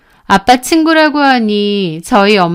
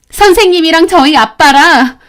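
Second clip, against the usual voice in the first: heated accusation; 6 semitones higher, 5 dB louder than usual. Retold or imitated. Student blurting accusation.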